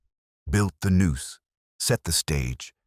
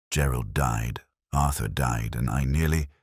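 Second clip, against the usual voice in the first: usual speaking voice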